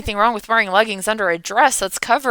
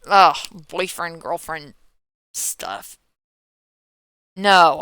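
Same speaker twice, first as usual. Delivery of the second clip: normal talking voice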